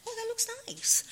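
high, soft mock gush; mock admiring. Naive wife admiring the mobsters' rings, high and gushing. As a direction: coo it way up high.

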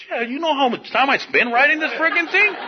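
high, exasperated complaint; indignant protest. Retold or imitated. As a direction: protest at having to redo the work. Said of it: "I said".

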